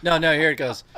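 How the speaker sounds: ordinary talk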